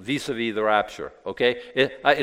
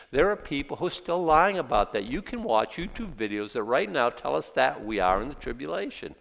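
ordinary delivery

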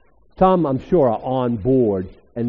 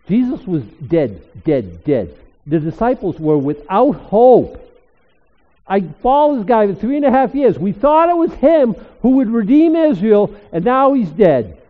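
loud, higher grieving preacher voice for the disciples; full-force preaching; 9 semitones higher, 10 dB louder than usual. Acted. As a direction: preach their lost hope, rising loud.

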